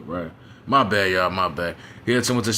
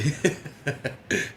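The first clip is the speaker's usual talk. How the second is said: quiet breathy laugh; 5 semitones higher, 7 dB quieter than usual. Real, not acted. Laughs mid-story.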